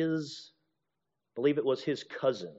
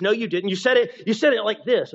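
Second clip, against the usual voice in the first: higher and louder, insisting hotly; indignant retort; 7 semitones higher, 7 dB louder than usual. Acted. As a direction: snap back in disbelief at the other. Acted quarrel about tone.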